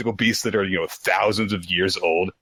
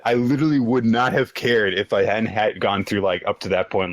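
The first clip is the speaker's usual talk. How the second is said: ordinary delivery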